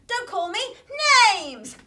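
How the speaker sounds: ordinary talk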